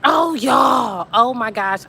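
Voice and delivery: loud burst of alarm; startled outcry. Real, not acted. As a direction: cry out in sudden dismay. Sudden realisation: no socks on.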